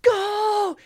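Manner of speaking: high, loud re-enacted game-day yell. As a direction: yell it like a fan to strangers. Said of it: "I was yelling with strangers".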